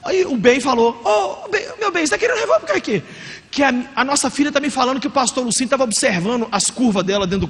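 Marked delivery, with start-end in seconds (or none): 1.0-3.0 high, fast, honeyed pleading; sweet-talking wife. Retold or imitated. Wife sweet-talks husband hunting for his gun, high coaxing voice. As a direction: coax him like a worried wife.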